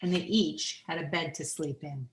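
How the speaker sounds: plain reading voice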